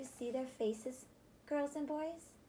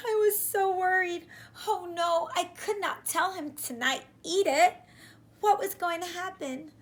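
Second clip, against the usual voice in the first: higher, louder frantic worry; panicked; 6 semitones higher, 8 dB louder than usual. Acted. Boy panicking as friend is about to eat pie. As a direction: rush it out in alarm.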